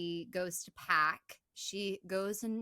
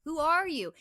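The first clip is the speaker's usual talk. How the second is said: higher and louder, insistent asking; frightened demand; 8 semitones higher, 6 dB louder than usual. Retold or imitated. Imitating Claire loudly demanding who is hiding in the closet. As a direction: press the question again and again. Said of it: "she says".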